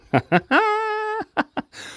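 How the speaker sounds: very high-pitched giggling laugh; real high cackle of laughter; burst of laughter. Real, not acted. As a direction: crack up in a high, helpless laugh. Cracks up after dropping everything.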